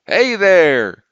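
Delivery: put-on high, loud old lock-keeper's call; thin, cracking hail. A narrator's reading. Lock-keeper hailing the boatmen in the storm, thin cracking shout. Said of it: "The hail came in a thin, cracking voice".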